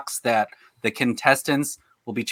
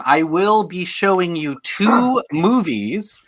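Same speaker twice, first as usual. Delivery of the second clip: drawn-out announcement; 3 semitones higher, slower than usual. Real, not acted. Host explains the game to contestants, drawn-out announcement. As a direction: stretch it out, slowly presenting the choice. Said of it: "Why did I have to say that?".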